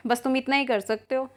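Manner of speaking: ordinary delivery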